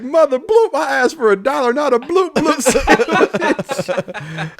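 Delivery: high, slow mock outrage, laughing through it; angry drunk guy. Drunk homeless man scolds the teen over the sandwich, high and laughing. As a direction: imitate the drunk man's indignant rant while cracking up. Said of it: "was like".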